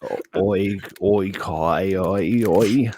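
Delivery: put-on botched Aussie accent sliding into Cockney; Cockney-sounding Australian accent. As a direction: warm up a bad Australian accent that lands in Cockney. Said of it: "not Cockney you're looking for".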